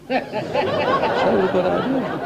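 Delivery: slow, laugh-filled echo of his own punchline; laughing repeat. Real, not acted.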